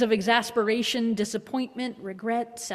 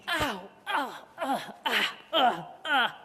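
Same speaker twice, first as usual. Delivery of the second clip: mock pained groans; 3 semitones higher, slower than usual. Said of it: "way over the top".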